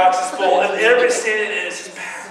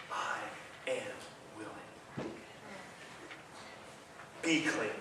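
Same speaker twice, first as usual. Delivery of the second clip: tender whisper; 8 semitones lower, 20 dB quieter, slower than usual. Acted. Jesus speaking tenderly to Larry.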